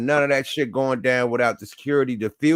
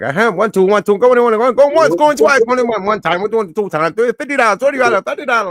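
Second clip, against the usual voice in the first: rapid-fire auction call, pitched up; auctioneer; 10 semitones higher, 7 dB louder, faster than usual. Retold or imitated. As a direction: rattle off bids like an auctioneer.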